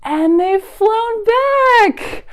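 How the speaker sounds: slow, very high thrilled voice; delighted. Acted. Librarian tells children the birds returned, thrilled. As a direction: marvel that they came back.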